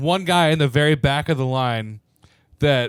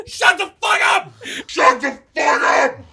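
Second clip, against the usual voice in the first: yelling; 12 semitones higher, 4 dB louder than usual. Retold or imitated. Bartender yelling at crowd.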